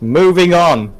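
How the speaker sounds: loud, high call to change the subject; jokily exasperated, loud and high; emphatic brush-off. Real, not acted. Loudly changes the awkward subject.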